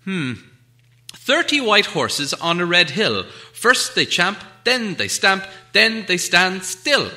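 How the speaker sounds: riddle recital. Acted. Bilbo recites his riddle.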